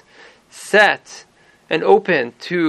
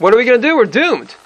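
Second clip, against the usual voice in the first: higher-pitched acted panic; freaking out; 6 semitones higher, 3 dB louder than usual. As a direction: cry out a mock-alarmed crowd's despair. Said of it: "they would freak out and say".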